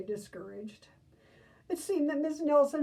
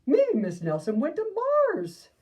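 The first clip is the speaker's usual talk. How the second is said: put-on excited kid; 10 semitones higher, 8 dB louder than usual. Kid offering a wild idea.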